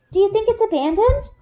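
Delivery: put-on high, worried young girl's voice; a small girl fretting over the lost cub; Runa. A narrator's reading. Little girl Runa asking Bryn about the cub, high and worried. Runa's lines in read story.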